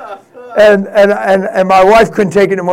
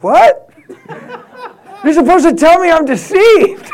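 louder, higher incredulous yelp with a laugh; surprised outburst; 10 semitones higher, 5 dB louder than usual. Retold or imitated. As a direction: blurt it out in disbelief, laughing. Son shocked dad approves.

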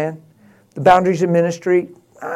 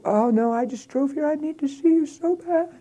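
much higher, teary woman's voice; pleading crying lady; 13 semitones higher than usual. Retold or imitated. Distraught woman begging the pastor to meet, high pleading voice. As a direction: beg him like a desperate stranger.

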